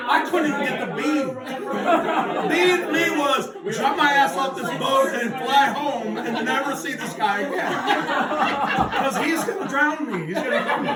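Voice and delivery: high, giggling through the words; cracking up while telling; laughing panic. Real, not acted. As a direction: laugh it out in disbelief.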